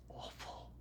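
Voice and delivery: a breathless whispered word. Acted. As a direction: let it out on a whisper. Remembering the feeling.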